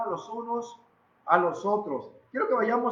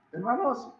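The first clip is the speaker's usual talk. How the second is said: laughing; 10 semitones higher, 4 dB louder than usual. Real, not acted. Laughs at his own remark.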